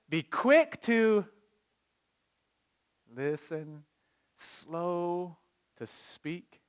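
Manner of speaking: very slow, deliberate phrasing; drawn out slowly. Shown as a demonstration. Preacher teaching the verse, slowly drawn out. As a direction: stretch each word as you teach it. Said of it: "preacher models slow speech".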